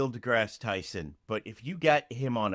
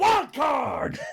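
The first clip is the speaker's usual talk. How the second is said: high-pitched, crazed yell; mock hype shout. Podcaster pitching a murderous wild card, comic shout. Yelled joke catchphrase.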